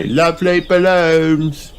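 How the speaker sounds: high, slow selling call; cartoon child stallholder; Pedro voice. A narrator's reading. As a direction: advertise it like a little pony. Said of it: "shouts Pedro Pony".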